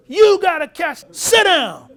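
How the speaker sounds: very loud, high barked order; loud high shout. Retold or imitated. Manager shouting at Saxy to sit down, laughing. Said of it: "He says".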